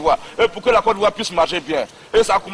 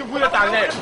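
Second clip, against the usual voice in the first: ordinary delivery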